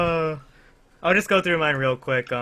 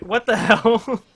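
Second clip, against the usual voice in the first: disbelief; 8 semitones higher, 6 dB louder, slower than usual. Retold or imitated. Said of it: "And I'm like".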